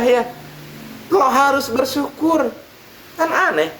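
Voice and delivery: high, baffled protest; Udin baffled. Acted. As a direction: sound truly puzzled by the advice. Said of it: "kata Udin". (Udin heran, nada tinggi)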